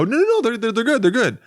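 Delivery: very high, squeaky denial; voice going up. Retold or imitated. Diner insisting to a doubtful waitress, voice shooting up. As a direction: leap way up in pitch, an obvious lie. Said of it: "voice goes up and the person knows you're lying".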